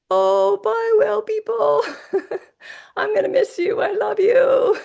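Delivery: imitating Alan. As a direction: call goodbye way up high and laugh as you go. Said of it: "So he's like".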